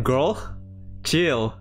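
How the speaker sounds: usual speaking voice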